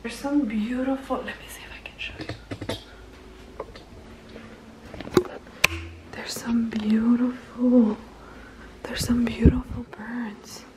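hushed whisper so the birds stay put. Real, not acted. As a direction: breathe the words softly so nothing flies off. Whispering so she won't scare the birds.

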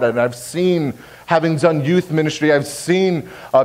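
ordinary talk

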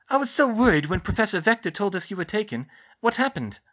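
put-on high, loud, worried girl; Ginny's character voice. Ginny telling Harry she was worried, high character voice. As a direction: let worry push the voice up.

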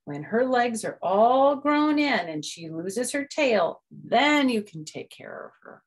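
mom voice. Acted. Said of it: "Froggy's mom".

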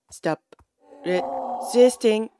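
drawn-out, broken-up order; slow insistent command. Pretend. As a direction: spell out the order slowly, word by word. Third order to donkey.

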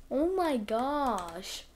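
ordinary talk, nothing marked

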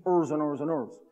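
each "ers" one syllable, like "errs"; "ers" for hours, rhythmically repeated; old pronunciation. Shown as a demonstration. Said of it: "the word hours was pronounced ers".

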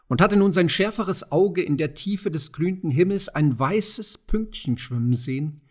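usual speaking voice

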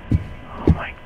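slow whisper of dread. Real, not acted. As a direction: whisper it under your breath. Creeped out by the game.

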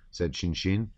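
usual speaking voice

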